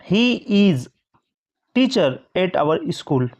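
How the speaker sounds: very slow dictation pace; slow example reading. Shown as a demonstration. Teacher dictating the example sentence slowly. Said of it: "तो हमने क्या बोल दिया".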